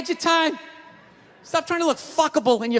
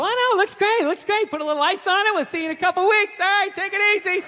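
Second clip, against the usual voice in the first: higher, fast, breezy reassurance; breezy surgeon; 6 semitones higher, faster than usual. Retold or imitated. Surgeon sending a botched patient home, breezy. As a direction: brush the patient off cheerfully. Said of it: "They're just lying to people".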